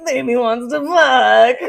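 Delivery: put-on slow, loud, whiny baby voice. Frank in baby mode calling for mommy, a whiny baby voice. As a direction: whine it like a huge toddler. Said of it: "baby mode".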